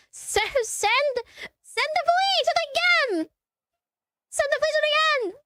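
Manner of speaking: high, drawn-out imitation of a desperate caller; mock panicked plea. Caller begging the operator for police, mock panicked.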